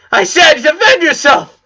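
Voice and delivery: high, loud tearful yell; a student screaming at her unmoving sensei, tearful yell. Acted. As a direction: shout it through tears at him. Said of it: "she yelled".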